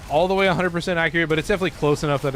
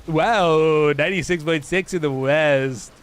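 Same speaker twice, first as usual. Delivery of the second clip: hammed-up radio DJ with a loud "Wow"; 3 dB louder, slower than usual. Streamer inventing a station ID, mock radio DJ. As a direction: belt it out like a station jingle. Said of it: "it's also like radio D J".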